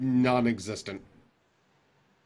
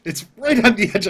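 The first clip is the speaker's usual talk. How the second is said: much louder and higher, almost shouting the words; loud excited repeat; 12 semitones higher, 14 dB louder than usual. Real, not acted. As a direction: belt it out in glee.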